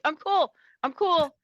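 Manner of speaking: high mock-casual voice insisting she's fine; acting cool. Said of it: "And I'm like".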